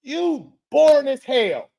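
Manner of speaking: slow, drawn-out mock scolding; slow sleepy mockery; mock telling off. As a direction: drag out the sleepy words mockingly. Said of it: "why you ain't told Paige".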